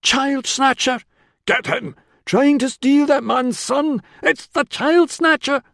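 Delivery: high, loud shouts of accusation; mob cry. A narrator's reading. Angry crowd turning on a suspected child snatcher, shouting high. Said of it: "Others' voices had taken up the cry".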